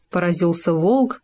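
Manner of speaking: ordinary delivery, nothing marked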